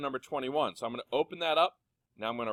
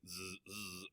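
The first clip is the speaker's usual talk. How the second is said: buzzing "zzz" of a vibrating phone; imitated phone vibration; 5 semitones lower, 18 dB quieter than usual. Teacher showing students how vibrate sounds, imitated buzz. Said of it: "makes that real loud annoying".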